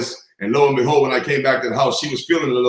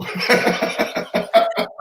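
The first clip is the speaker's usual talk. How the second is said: laughing; 11 semitones higher than usual. Real, not acted. Laughing at the story's end.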